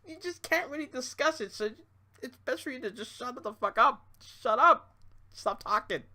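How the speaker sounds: ordinary talk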